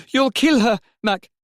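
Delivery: high, loud protest down the phone; calling out urgently. A narrator's reading. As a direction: cry out to stop him. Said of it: "he called".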